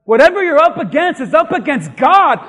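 high, loud preacher's declaration; full-force preaching. Acted. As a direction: declare it loudly from the pulpit.

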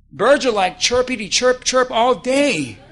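ordinary talk, nothing marked

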